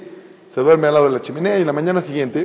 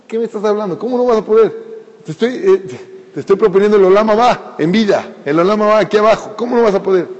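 higher-pitched, incredulous protest; indignant disbelief; 4 semitones higher than usual. Acted. House owner to young rabbi refusing the bakery job, indignant disbelief. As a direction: argue back as if baffled by the refusal.

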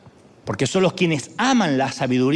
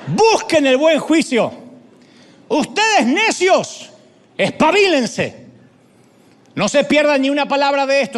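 high, loud, stretched street call; Lady Wisdom shouting; 7 semitones higher, 4 dB louder than usual. Acted. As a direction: cry out the warning to the foolish crowd. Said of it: "Está hablando la sabiduría, no yo". (la sabiduría gritando en la plaza, aguda y fuerte)

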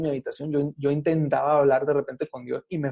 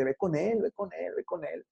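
fading urging; 3 semitones higher, 7 dB quieter than usual. Retold or imitated. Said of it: "le dice".

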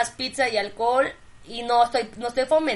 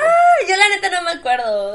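laughing; 8 semitones higher, 9 dB louder than usual. Real, not acted. Laughing, still cracked up.

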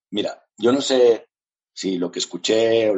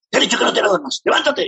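voz insistente en la cabeza; 13 semitones higher, faster than usual. Retold or imitated. La voz insiste otra vez.

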